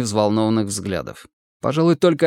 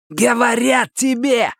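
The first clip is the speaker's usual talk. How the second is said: high, drawn-out snarl; snarling retort; 12 semitones higher, slower than usual. A narrator's reading. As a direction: snap back with bared teeth. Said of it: "ощерился Мазур". (злобный высокий окрик)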